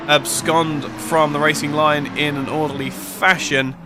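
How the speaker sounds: fast, lively play-by-play call; live race commentary. Acted. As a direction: call the final laps like live sport.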